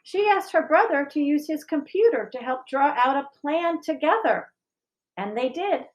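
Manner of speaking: lifted storytelling voice; story narration. A narrator's reading. Teacher reading a picture book to the school, lifted narration. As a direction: read it like storytime, a little brighter.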